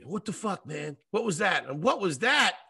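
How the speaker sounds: retold yelling. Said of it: "starts yelling at me".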